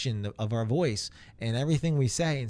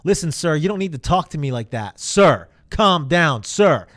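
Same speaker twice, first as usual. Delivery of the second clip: rep losing temper; 3 semitones higher, 10 dB louder than usual. Retold or imitated. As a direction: snap back at the caller, shouting. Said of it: "they start talking to the customer like that".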